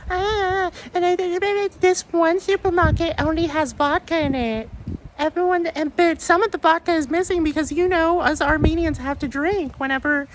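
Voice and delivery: very high, put-on mocking voice; mocking impression. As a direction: squeak it out like a silly caricature.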